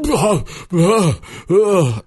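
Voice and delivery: high, loud sputtering gasps; a little kid coughing up water; child's panicked gasps. Retold or imitated. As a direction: gasp for air like a scared toddler. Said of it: "he goes".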